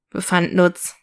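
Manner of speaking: ordinary delivery, nothing marked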